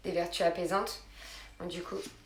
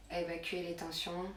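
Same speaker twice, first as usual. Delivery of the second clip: soft, calm yoga-teacher voice with long pauses; guided relaxation; 10 dB quieter than usual. Real, not acted. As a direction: ease them gently into letting go. Leads a relaxation session. (voix douce et posée de relaxation guidée)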